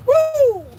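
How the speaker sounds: sudden high yelp of disbelief; loud high whoop. Real, not acted. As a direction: let out a sharp shocked woo. Reacting to the hurtful words.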